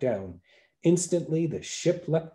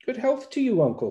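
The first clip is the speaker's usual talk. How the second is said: the fool greeting; 12 semitones higher than usual. A narrator's reading.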